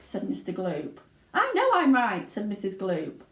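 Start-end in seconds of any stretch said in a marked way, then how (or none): 1.3-2.3 high loud retort; indignant. A narrator's reading. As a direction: insist hotly. Wife retorts.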